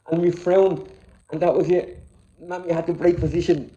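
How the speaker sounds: usual speaking voice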